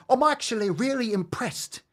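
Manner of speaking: very high, theatrical mock delivery; mimicking the influencer. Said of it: "She's like William Shatner".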